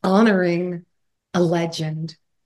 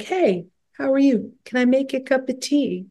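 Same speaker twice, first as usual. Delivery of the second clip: lightly imitated English accent, pitched higher; 6 semitones higher than usual. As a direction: offer it politely with a hint of English accent. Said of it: "With his English accent".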